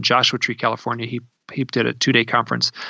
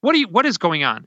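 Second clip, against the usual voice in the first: retold angry demand, higher pitched; mad; 6 semitones higher than usual. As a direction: imitate an indignant caller demanding answers. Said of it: "she's mad".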